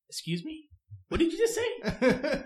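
imitating an offended coworker, pitch jumping up; playful, high-pitched indignation; mock outrage. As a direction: act affronted, drawing out the challenge. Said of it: "like, curse him out".

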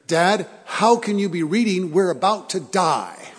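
put-on loud, urgent child's alarm; loud panicked son. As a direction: sell the kid's panic, loudly. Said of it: "he said it in a loud voice".

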